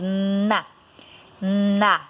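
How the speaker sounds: "na" sounded out alone; sound demonstration of N. Shown as a demonstration. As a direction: say "na" slowly with a held N. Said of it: "observe que o N, ele foi produzido".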